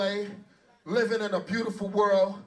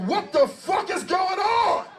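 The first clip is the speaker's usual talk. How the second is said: astonished shout; 7 semitones higher, slower than usual. Retold or imitated. Retelling his shock at daytime rap.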